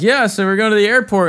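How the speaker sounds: breezy, high and loud, like nothing's wrong; casual cheerful. Retold or imitated. Acting out his own cheerful line.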